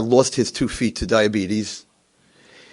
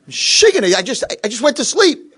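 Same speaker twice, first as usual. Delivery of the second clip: high, loud grumble at being woken; cranky protest; 13 semitones higher, 7 dB louder than usual. Retold or imitated. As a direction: complain loudly as a rudely woken old man.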